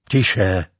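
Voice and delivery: low, curt call for silence; understated gruff command; gruff hushing order. A narrator's reading. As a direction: hush them with one low word. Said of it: "houkl Bikarat".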